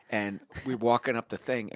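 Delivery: usual speaking voice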